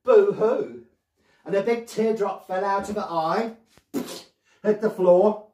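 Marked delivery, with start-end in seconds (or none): 0.0-0.8 loud, high pretend boo-hoo; mock crying. Storyteller as Lady Clarabella missing her necklace, mock crying. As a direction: wail it out in hammed-up storybook sobs. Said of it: "She started to cry like this".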